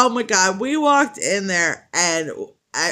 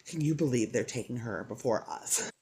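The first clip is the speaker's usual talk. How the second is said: muttered aside; 8 semitones lower, 12 dB quieter than usual. Retold or imitated. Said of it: "She's like".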